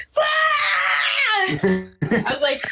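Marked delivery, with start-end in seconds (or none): 0.1-0.2 long high-pitched scream; excited scream. Retold or imitated. Guest judge seeing [name] walk out, excited scream. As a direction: scream it out, stretched and overwhelmed. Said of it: "she was like".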